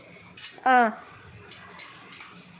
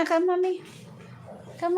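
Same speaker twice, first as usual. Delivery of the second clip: ordinary talk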